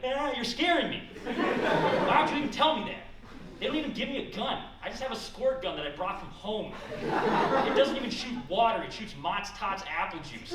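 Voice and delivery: high, loud, panicky agent voice; scared TSA agent. Acted. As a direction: act out a frightened security agent protesting. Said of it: "The T S A agent will just be like".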